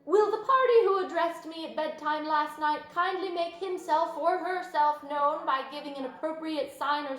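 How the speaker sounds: loud, formal public-address delivery; loud firm announcement. A narrator's reading. Wilbur firmly asking the unknown friend to show itself. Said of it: "in a loud, firm voice".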